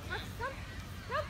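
calling the puppy to come back; calling the dog. Real, not acted. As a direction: coax the dog over.

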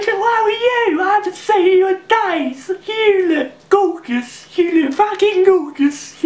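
very high, loud squeal of affection; gushing falsetto character. Acted. Character greeting a darling unseen for days, gushing falsetto. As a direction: coo shrilly as if demanding a kiss.

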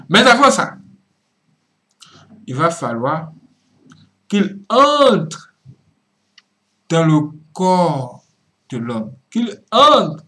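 loud bursts on key words, drawn out; forceful preaching. Real, not acted. Preacher warning how the devil enters man, forceful preaching. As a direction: proclaim it in weighty, separated phrases.

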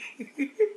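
short high giggle; a quick squeaky giggle. Real, not acted. Still giggling over cleaning chitlins.

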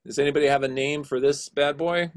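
ordinary talk, nothing marked

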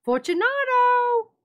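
calling aloud. A narrator's reading. Montresor calls aloud to Fortunato behind the wall. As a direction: call his name into the dark niche. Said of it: "I called aloud".

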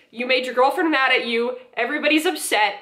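ordinary delivery

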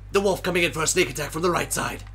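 fast, excited play-by-play; excited narration. Acted. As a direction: call the attack with rising urgency.

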